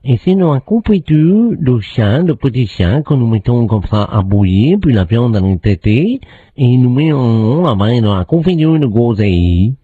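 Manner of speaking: lightly put-on Chinese accent; a hint of a Chinese accent. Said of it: "Un, un Chinois".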